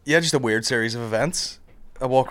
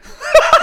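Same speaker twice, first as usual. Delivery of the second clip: shrieking laugh; a real squealed laugh; high burst of laughter; 7 dB louder than usual. Real, not acted. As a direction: crack up high and loud. Cracking up at the story.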